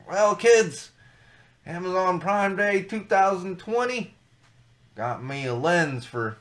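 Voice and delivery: drawn-out, slow Southern storytelling voice; Southern accent. Pretend. Future grandparent telling the grandkids about Prime Day, Southern accent. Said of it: "I went into a Southern accent".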